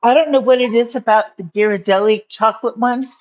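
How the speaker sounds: normal talking voice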